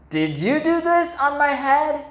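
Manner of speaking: high, loud, outraged question; indignant mole. Acted. Mole confronting the hare about poop on his head. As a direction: demand an answer, offended. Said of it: "he asked the hare".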